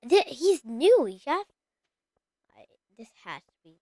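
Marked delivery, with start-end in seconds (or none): 2.6-3.8 whisper. Real, not acted. Murmurs to self while playing.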